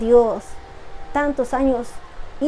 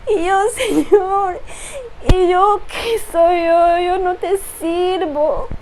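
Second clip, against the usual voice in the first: higher, voice breaking with real tears; pleading through tears, raised pitch; tearful testimony; 4 semitones higher than usual. Real, not acted. Woman confessing to God through real tears. Voice breaking while retelling prayer.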